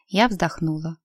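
ordinary delivery

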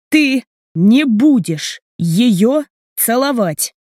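slow, emphatic, word-by-word warning; deliberate pauses, each word punched; hammering each word. A narrator's reading. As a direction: space out every word as a hard prohibition. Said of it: "Также чеканит Платон".

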